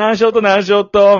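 high, very loud, repeated Hakata-dialect call. Retold or imitated. Fukuoka man badgering a woman, exaggerated Hakata dialect. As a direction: pester loudly in thick Hakata dialect. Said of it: "博多弁で行けや".

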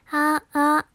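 high, loud test notes; voice-change test. Shown as a demonstration. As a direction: try pitching your voice up, loudly. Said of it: "変わってんのかな". (高めの声で発声テスト)

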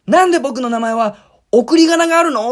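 put-on high, loud furious kid's complaint; angry child. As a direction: protest like an outraged little boy. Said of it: "ものすごい怒って、". (怒った子供の甲高い大声)

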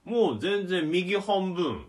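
slow, careful words through a numbed mouth. Real, not acted. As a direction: speak slowly so you don't bite your tongue. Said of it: "ゆっくり喋るしかないよ".

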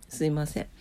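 ordinary talk

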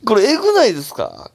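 high, loud astonished question; excited disbelief. Real, not acted. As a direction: appeal to listeners, bowled over. Gushing over the listener story. (興奮して驚く)

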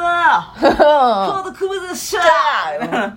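slow hammed-up Kansai stage shout; mock stage shout. Playful concert farewell to the crowd, shouted. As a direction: yell it like an arena closer.

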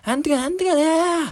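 high, mumbled auntie voice, vague words; a sing-song drunk middle-aged woman; imitating a drunk auntie. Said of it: "その一人の女性のおばさんみたいな人". (酔ったおばさんの高い声の真似)

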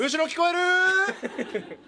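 drawn out and high, calling to the back; calling from far away. Real, not acted. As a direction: shout it slowly toward the far seats.

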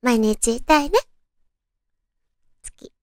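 ordinary delivery

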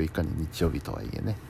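ordinary talk

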